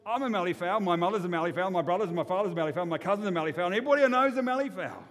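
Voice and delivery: indignant insistence swelling to a shout; insistent, building. Acted. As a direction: protest harder with every line. Davo insists, getting worked up.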